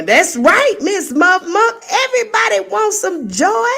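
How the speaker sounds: light teasing lilt; chirpy playful reply; playful high voice. Real, not acted.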